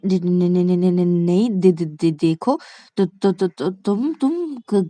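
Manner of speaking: stuck syllables, groping for words; scared stammer. Acted. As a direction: stammer through an awkward love confession. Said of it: "कैसे डरती है ये मुझसे".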